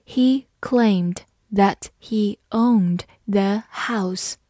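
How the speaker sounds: spaced-out careful model sentence with pauses between words; slow reading. Shown as a demonstration. Teacher reading the example slowly for learners, slow reading. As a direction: read slowly, word by word. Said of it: "我们慢读一遍".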